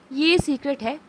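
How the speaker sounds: ordinary delivery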